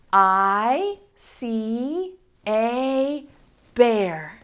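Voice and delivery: very slow, word by word; slow reading. Shown as a demonstration. Teacher reading word by word to preschoolers. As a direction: point and read each word slowly. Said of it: "Let's do it together".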